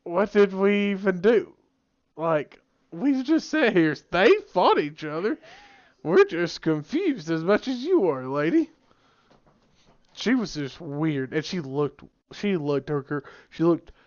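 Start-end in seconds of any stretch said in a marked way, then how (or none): 0.0-8.7 higher-pitched, baffled protest; mock bewilderment, pitched up; bewildered students. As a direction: imitate confused kids questioning a teacher. Baffled students answering the screaming teacher.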